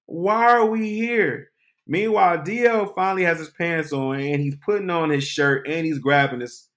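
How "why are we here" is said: bewildered. Retold or imitated. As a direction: ask slowly in total confusion. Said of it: "I'm like".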